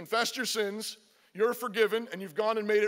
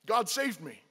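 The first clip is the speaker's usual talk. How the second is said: usual speaking voice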